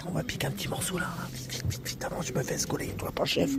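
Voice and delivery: hushed, sneaky whisper. Real, not acted. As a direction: whisper so nobody hears.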